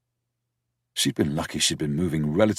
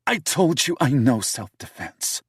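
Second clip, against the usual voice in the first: higher woman's voice, prickly; bristling woman; 9 semitones higher than usual. A narrator's reading. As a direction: bristle back defensively at him. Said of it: "she bristled".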